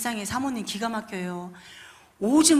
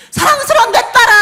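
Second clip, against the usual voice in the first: loving welcome; 12 semitones higher, 15 dB louder than usual. Acted. God welcoming his daughter home.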